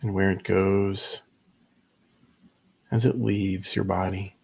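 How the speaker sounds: unhurried meditation guide, long pauses between phrases; measured, spacious guiding voice; guided meditation. Acted. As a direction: ease listeners into stillness, leaving space after each instruction.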